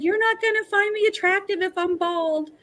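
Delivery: very high, thin tearful wail; retold crying. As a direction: re-enact wailing through tears, pitch way up. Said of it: "I just started crying".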